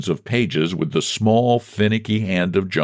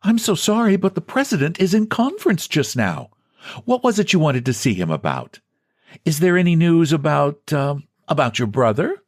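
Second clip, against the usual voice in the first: a lightly sweet, higher secretary voice; 8 semitones higher than usual. A narrator's reading. Secretary sweetly putting off a visitor, lightly sweet. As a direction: coo it politely, a hint of sweetness. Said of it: "sweetly confided to John".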